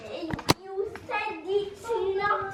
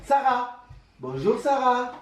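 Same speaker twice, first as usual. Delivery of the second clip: playing papa; 8 semitones lower than usual. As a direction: lower put-on papa voice. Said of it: "Bonjour, papa".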